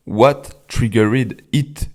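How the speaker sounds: slow English question in a heavy French accent. Shown as a demonstration. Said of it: "parce que mon accent est déplorable". (anglais lent avec un fort accent français)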